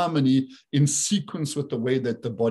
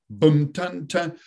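main-beat drum demo; slower than usual. Shown as a demonstration. Teacher repeating main beat and two offbeats, waltz drum demo. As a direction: voice a three-beat bar, first beat strong. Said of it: "you had your main beat and you got two offbeats".

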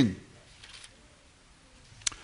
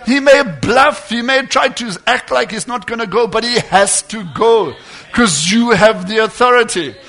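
louder, driving sermon cadence; preaching at full force; 3 semitones higher, 7 dB louder than usual. Acted. Preacher urging believers to use their authority, full force. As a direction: preach it hard to the congregation.

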